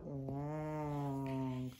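slow, stretched-out wonder; drawn out. Acted. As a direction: stretch the words to show how long. Marvelling at long neck.

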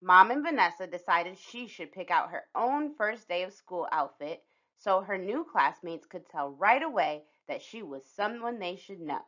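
plain reading voice

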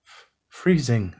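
stuttering through chattering teeth; cold mouse stammering, lightly voiced. A narrator's reading. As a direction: shiver out each f, slowly. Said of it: "with chattering teeth".

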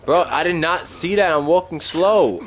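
high, loud startled protest; startled outcry. Real, not acted. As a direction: blurt it out after the jump scare. Startled by unseen enemy.

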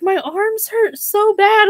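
high, slow whimpering complaint; whiny complaint. Retold or imitated. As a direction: replay your own whine to your husband. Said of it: "And I was like".

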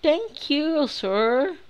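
very high mock female voice; imitando voz feminina irritante. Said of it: "Essa mulher tem uma voz muito irritante". (voz de mulher irritante, bem aguda)

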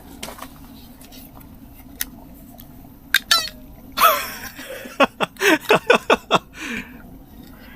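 숨넘어가게 높은 웃음소리; 참지 못하고 터지는 폭소. Real, not acted. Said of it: "아, 웃겼다". (숨 넘어가는 폭소)

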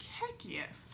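breathy little whisper; whispered reply. Retold or imitated. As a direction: whisper it like a secret thrill. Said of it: "I was like".

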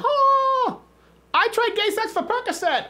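high-pitched mock shriek of a voice; a squealing high parody of the doctor's plea; imitating the doctor. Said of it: "if you'd have just gone".

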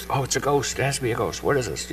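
soft, spooked murmur, voice held down; frightened disciples whispering in the storm; hushed fright. Acted. Disciples in the boat seeing a figure, hushed fear.

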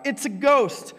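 high frightened cry; a sudden scared yell, voice jumping up; cried out in fear. A narrator's reading. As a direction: shout it like you've seen a ghost. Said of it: "they cried out".

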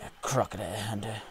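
a light hint of an Australian accent; playfully channel the crocodile hunter in Australian; mock Australian accent. Crouching through swamp as crocodile hunter, mock Australian accent. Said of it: "I feel like a crocodile hunter".

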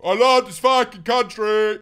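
proud shout of love for the country. Real, not acted. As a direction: belt it out the second time. Says the line twice, bigger.

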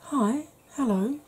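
usual speaking voice

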